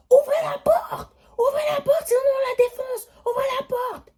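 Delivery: high, loud crying shouts; imitated frantic banging yells; frantic shouting. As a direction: yell through the door in a panic. Imitating people shouting at door.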